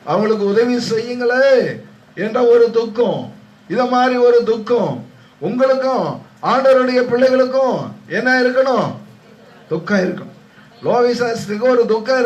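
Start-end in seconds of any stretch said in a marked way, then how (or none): 0.0-8.9 high-pitched, urgent exhortation; impassioned preaching. Real, not acted. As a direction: press the appeal on the congregation. (உணர்ச்சியான உயர்ந்த குரல் பிரசங்கம்)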